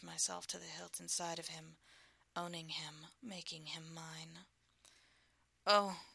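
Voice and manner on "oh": sudden loud gasp of pleasure; loud pleasured gasp. Acted. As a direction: let it burst out. Lover gasps in pleasure.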